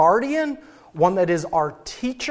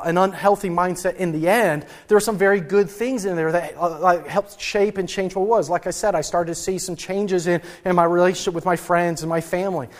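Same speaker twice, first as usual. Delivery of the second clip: ordinary talk, nothing marked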